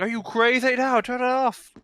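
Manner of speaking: high, loud mock panic; panicked bureaucrat. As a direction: yell it like an alarmed tiny worker. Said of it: "someone's like".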